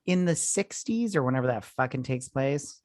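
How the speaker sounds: ordinary delivery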